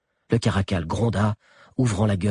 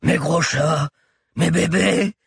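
high, raised cry; 8 semitones higher, 4 dB louder, slower than usual. A narrator's reading. Orfeo as the claws reach his back, high cry. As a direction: call out to the beasts, pitch climbing. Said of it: "cria Orfeo".

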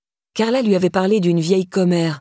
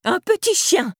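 put-on very high old-woman cry; high elderly holler; shouting old woman; 12 semitones higher than usual. A narrator's reading. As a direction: call it after him, pitched way up. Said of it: "cria la vieille derrière lui".

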